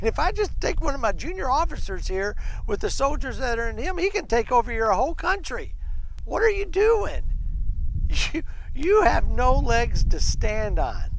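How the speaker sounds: high, incredulous mock boast; mocking insults. Commander insulting a hopelessly outmatched kingdom, mocking. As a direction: taunt them with rising exasperation and force. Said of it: "He's mocking them. He's insulting them".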